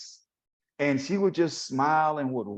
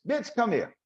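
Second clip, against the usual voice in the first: a higher, affectionate Aunt Mina call; lightly voiced aunt calling someone over; imitating Aunt Mina; 11 semitones higher than usual. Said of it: "she'll call you a bitch".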